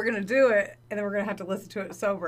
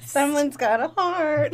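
teasing; 7 semitones higher, 7 dB louder, much slower than usual. Real, not acted. Teasing friend about sentiment.

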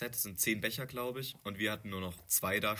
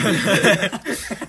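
loud laugh; 11 semitones higher, 19 dB louder than usual. Real, not acted. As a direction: burst of high loud laughter. Laughs at grinning colleague joke.

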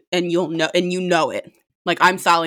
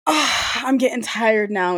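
sleepy date; 4 semitones higher, slower than usual. Retold or imitated. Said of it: "is what she says".

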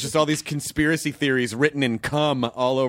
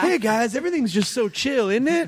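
higher-pitched, put-on carefree greeting; mock chill; 7 semitones higher than usual. Friend acting out his changed demeanor to the others, mock chill and high. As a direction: pretend everything is totally fine, pitched up.

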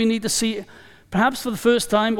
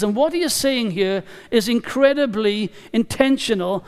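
ordinary talk

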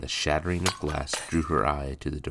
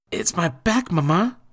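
put-on much higher boy's whine; complaining boy; 7 dB louder than usual. Boy complaining to his mother, high and whiny. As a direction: plead about the sore back. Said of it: "Jacques complained".

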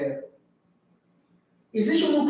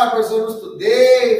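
whiny student; 8 dB louder than usual. Retold or imitated.

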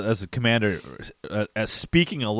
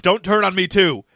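higher-pitched accusing cry; mock outburst; 7 semitones higher, 4 dB louder than usual. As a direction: act out his sudden mood swing. Acting out the man's mood swing.

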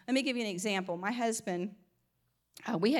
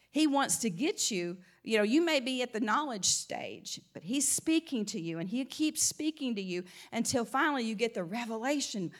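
usual speaking voice

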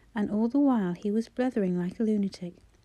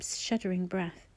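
ordinary delivery

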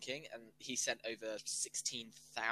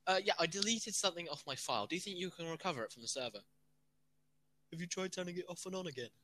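hapless user and IT guy; 6 semitones higher, faster than usual. Retold or imitated. Acted IT helpdesk exchange.

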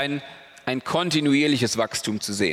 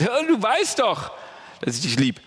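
acted dismay, higher and slowed at the start; dismayed and desperate; 6 semitones higher than usual. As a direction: plead it like a hurt friend who isn't believed. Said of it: "so ein bisschen entsetzt und verzweifelt".

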